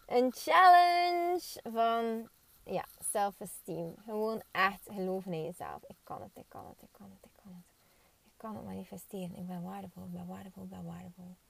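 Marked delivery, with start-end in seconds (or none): 0.1-2.3 slow, stretched "challenge" up high; drawn-out challenge. Shown as a demonstration. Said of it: "Misschien moet ik daar een challenge van maken". 5.9-11.4 hushed affirmations to yourself; whispering. Pretend.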